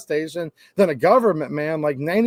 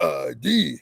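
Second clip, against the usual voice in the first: low, drawn-out demand for ID; mock drill instructor command; boot camp sergeant; 5 semitones lower, 4 dB quieter than usual. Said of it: "that type of clerk".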